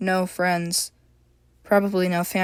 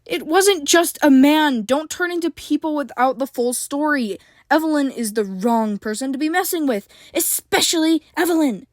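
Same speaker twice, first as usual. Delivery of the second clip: angry snap; 7 semitones higher than usual. A narrator's reading. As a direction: snap at him firmly. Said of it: "April snapped".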